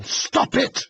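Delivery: sudden high, loud scream; shout. A narrator's reading. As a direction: yell it with all your breath. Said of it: "she shouted".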